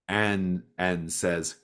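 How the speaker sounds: ordinary delivery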